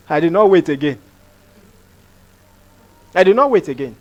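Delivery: ordinary delivery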